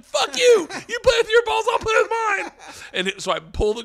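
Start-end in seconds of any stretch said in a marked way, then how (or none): 0.0-2.5 high, very loud comic shouting; outraged yelling. Retold or imitated. Skydiver answering the misheard order mid-fall, outraged yelling. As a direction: shriek back in hammed-up indignation. Yells back at instructor in free fall.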